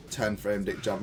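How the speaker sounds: ordinary talk, nothing marked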